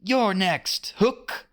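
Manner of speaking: put-on high boyish call from above; boyish shout. A narrator's reading. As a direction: call down a bold challenge. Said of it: "a voice shouted from above".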